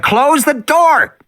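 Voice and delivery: high, slow, drawn-out shouted order; urgent shout. Acted. Tim yelling at Lex to close the door. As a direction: yell it at your sister in panic. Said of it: "Tim said".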